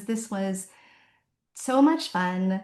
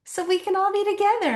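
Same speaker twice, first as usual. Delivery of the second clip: ordinary delivery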